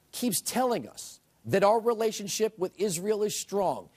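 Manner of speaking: usual speaking voice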